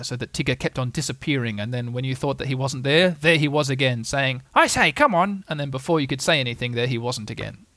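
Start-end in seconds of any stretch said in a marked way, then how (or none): 4.5-5.4 high, loud, eager summons; bouncy calling out. Acted. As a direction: call to friends through the mist. Tigger calling from the mist.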